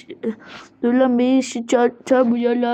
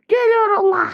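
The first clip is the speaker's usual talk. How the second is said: higher-pitched, more worked-up call; raised cry; 7 semitones higher than usual. Pretend. Teacher setting off after the other children, high cry. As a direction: throw it out high and heated. Repeated, raised threat of coming.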